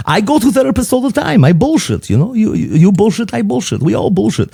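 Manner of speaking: high, animated Greek old man impression; Greek accent. Father-in-law explains he means no insult, Greek accent. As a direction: rattle on in a put-on Greek accent. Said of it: "my best Greek impression".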